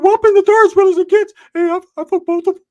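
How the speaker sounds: way up high and loud, cocky friend bragging; loud high cartoonish bro voice; high-pitched Ricky voice. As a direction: squeak it out like a boastful buddy. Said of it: "Ricky's like".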